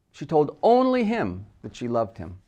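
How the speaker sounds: "only him" lifted and punched, then falling; single out "only him" with weight; stress on ONLY HIM. Shown as a demonstration. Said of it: "Placing the word only".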